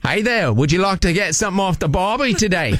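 broad put-on Aussie accent; Australian accent. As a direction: greet them in a hammed-up Australian accent. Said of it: "with a slight Australian accent".

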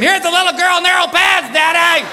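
high-pitched imitated kid's yell; child screaming out. Eight-year-old son yells to dad in drugstore, screaming out. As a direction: holler it proudly across the whole store. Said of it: "proceeds to scream out".

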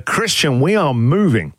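ordinary talk, nothing marked

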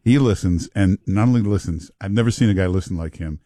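normal talking voice

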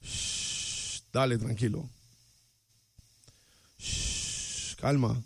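low, soft soothing hushes; soft shushing. Real, not acted. Host quietly shushing and calming someone on air. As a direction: hush and calm someone gently.

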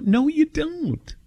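high, slow teasing "No, you don't."; husband teasing his wife, drawn-out and high; flat contradiction. Retold or imitated. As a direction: drag it out, teasing. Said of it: "I go".

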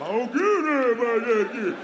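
loud dumb caveman growl. Retold or imitated. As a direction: bellow it like a caveman. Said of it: "same caveman voice".

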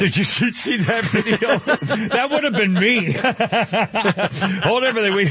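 high-pitched, helpless laughing talk; real laughter breaking into speech; cracking up. Real, not acted. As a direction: laugh hard right through the words. Cracking up over a video.

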